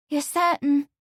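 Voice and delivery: high, hesitant maid's question; hesitant young woman. A narrator's reading. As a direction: ask shyly, hoping. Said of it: "with a pretty hesitation".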